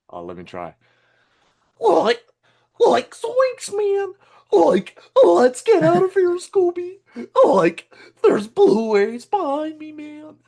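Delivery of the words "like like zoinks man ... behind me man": much higher, loud stoner drawl of a cartoon slacker; squeaky panicky Shaggy, all "like, man"; high cartoon Shaggy voice, like, zoinks; Shaggy impression. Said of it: "I can do a good Shaggy impression".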